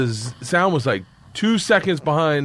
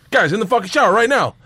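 put-on higher-pitched coach ordering boys along; impatient coach; 6 semitones higher than usual. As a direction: order the team into the showers. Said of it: "Coach Sayo comes out again".

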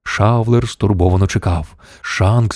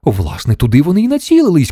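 high, quick alarmed call; crying out; 7 semitones higher than usual. A narrator's reading. Shanks warns Mission Control about the space hotel, crying out. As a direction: call it out in a rush. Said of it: "крикнув Шанкс".

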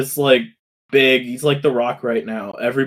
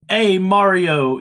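put-on New York Italian accent; 4 semitones higher, 3 dB louder, slower than usual. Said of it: "I can't do it".

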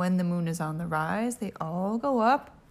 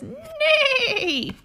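very high laughing neigh; whinny like a horse; 6 dB louder than usual. Acted. Horse sound.

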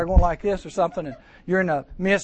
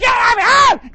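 very high, loud shouted outburst; mock yell; 12 dB louder, much slower than usual. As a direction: bark it like an angry kid in the car. Acted family quarrel on the way.

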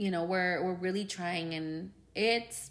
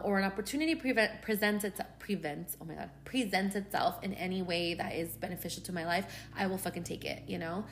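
ordinary talk

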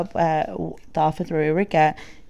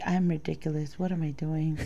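muttered to self; 4 semitones lower, 8 dB quieter than usual. Retold or imitated. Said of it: "I was like".

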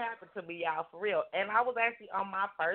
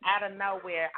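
ordinary talk

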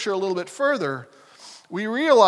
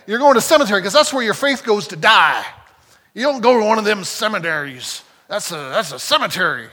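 put-on southern accent; 5 dB louder than usual. Mimicking old preachers warning against seminary, light Southern accent. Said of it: "why am I slipping into a southern accent".

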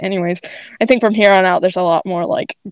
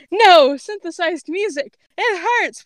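pained protest; 8 semitones higher than usual. A narrator's reading. Cypher hears synthesized music, pained high protest. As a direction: wail as if the music hurts.

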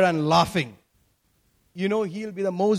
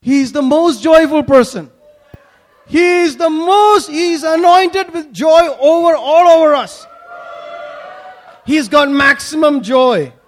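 loud, raised, emphatic proclamation; exultant preaching; 6 semitones higher, 7 dB louder than usual. Real, not acted. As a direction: proclaim it loudly with joy. Preacher exclaims Jesus' maximum joy.

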